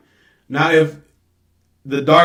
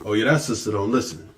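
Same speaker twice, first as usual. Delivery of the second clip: much lower and softer whining imitation; mock complaint; 8 semitones lower, 7 dB quieter than usual. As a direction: grumble the excuse low. Mimicking people complaining.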